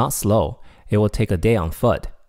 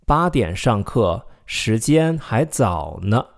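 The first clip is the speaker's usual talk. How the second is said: slow, careful read of 八点上课，时间还早呢; slow model sentence; slower than usual. Shown as a demonstration. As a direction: say it slowly, rising at the end. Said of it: "let's take a look at more examples".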